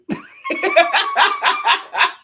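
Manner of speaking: high-pitched burst of laughter; real squealing laugh. Real, not acted. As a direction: crack up at the joke. Laughing at own lyric quote.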